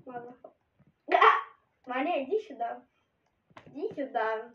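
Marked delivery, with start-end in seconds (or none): none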